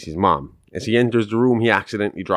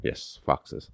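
whispered aside; 11 dB quieter than usual. Real, not acted. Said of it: "confirming foxes".

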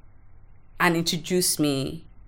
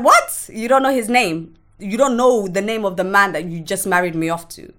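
shocked disbelief; 2 semitones higher, 3 dB louder than usual. Retold or imitated. Daughter to father, shocked he doesn't know the man's name. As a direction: shriek the first word, then press on in disbelief. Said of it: "I was like".

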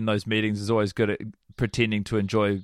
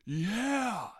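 very soft, high-pitched mock call-out; Nick Swardson heckle; 9 semitones higher, 11 dB quieter than usual. Mimicking the same audience member answering the comic, high soft heckle. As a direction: mimic a far-away heckler, high and small. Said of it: "The same voice goes".

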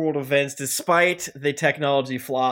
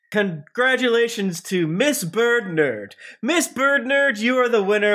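winner announcement; 6 semitones higher than usual. Real, not acted.

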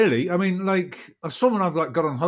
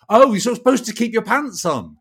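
imitating a startled masseuse, higher; mock-scandalised correction; 7 semitones higher, 4 dB louder than usual. Masseuse correcting the naked client, mock-scandalised. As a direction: retell a surprised telling-off.

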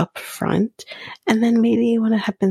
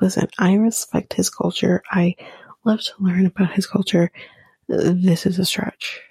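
usual speaking voice

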